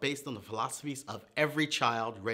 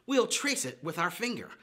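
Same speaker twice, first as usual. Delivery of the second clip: normal talking voice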